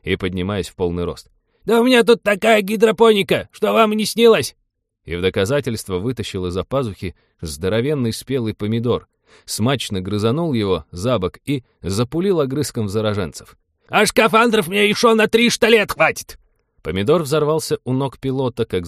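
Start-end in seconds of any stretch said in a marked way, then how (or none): 1.7-4.5 very high, loud crotchety grandpa; loud derisive old-geezer crowing; boastful scornful old man. A narrator's reading. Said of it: "презрительно фыркнул дед". 13.9-16.4 very high, loud crotchety grandpa; high-pitched cranky crowing; boastful old man. A narrator's reading.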